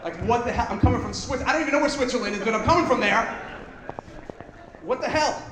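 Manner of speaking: high, loud, fast mock freak-out; mock panic. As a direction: rant like a panicked ticket buyer. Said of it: "your heart would be like".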